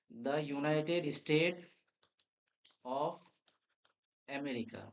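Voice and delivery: slow dictation. Shown as a demonstration. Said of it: "পুরো নামটা কিন্তু আমাকে লিখতে হতো".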